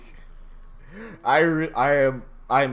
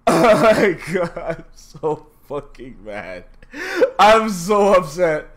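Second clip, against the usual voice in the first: louder, slow, laughing through the words; laughing in frustration; 4 semitones higher, 7 dB louder, slower than usual. Real, not acted. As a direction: laugh at your own misery.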